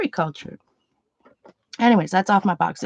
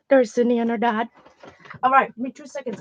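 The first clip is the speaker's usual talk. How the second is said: ordinary talk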